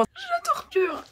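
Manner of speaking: shrill incredulous echo of torture; mock shock. Real, not acted. Youtubeuse echoes the hater's word, outraged and mocking. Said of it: "Oh là, carrément".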